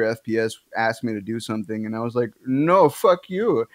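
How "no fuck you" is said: put-on slow, drawn-out Indian accent retort. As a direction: snap it back slowly in a put-on Indian accent. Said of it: "an immediate reaction in my Indian accent".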